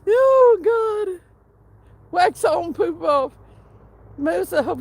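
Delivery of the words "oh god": much higher, much louder exclamation; laughing outburst. Real, not acted. Streamer reacts to chat with a loud laughing cry. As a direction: burst out in laughter.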